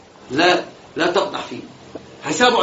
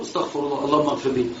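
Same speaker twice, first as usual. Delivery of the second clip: hushed pious plea; 6 semitones lower, 6 dB quieter than usual. Retold or imitated. Pious reply to blasphemy.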